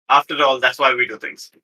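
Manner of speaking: normal talking voice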